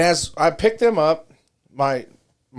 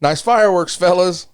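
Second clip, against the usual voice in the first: retold yell; 5 semitones higher, 4 dB louder than usual. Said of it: "and I yell".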